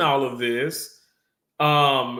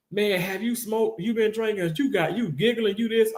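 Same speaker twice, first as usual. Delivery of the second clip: higher voice of people quizzing him, half-joking; a light, higher imitation of doubting friends; mock suspicious friends; 7 semitones higher than usual. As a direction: play the nosy friend asking if he's high. People asking if he's high.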